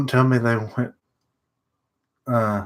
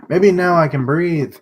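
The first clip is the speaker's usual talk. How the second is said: higher, louder, laughing relief; relieved laugh; 7 semitones higher, 5 dB louder than usual. Real, not acted. Finally breathing after a clogged nose, laughing with relief.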